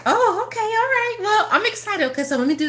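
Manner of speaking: high excited chirp settling toward normal; giddy excitement. Acted. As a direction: squeal it out like great news just landed. Acting an excited planner.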